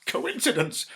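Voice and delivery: loud, halting word; stammering. Acted. Husband stammers to his wife, loud and halting. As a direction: stumble over it in fear. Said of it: "stammered the old man".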